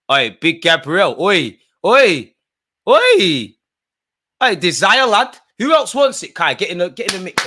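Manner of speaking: loud, high rallying shouts at the pitch; yelling at players. Real, not acted. Fan at the screen urges Gabriel into the fight, loud high shouting. Calling out to players mid-scuffle.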